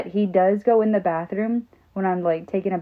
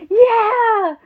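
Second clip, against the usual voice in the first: put-on much higher and louder, a child's proud squeal; excited kid voice; 14 semitones higher, 10 dB louder than usual. Son proudly telling mom about school, high excited kid voice. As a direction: play the boy, thrilled to tell. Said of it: "And he's like".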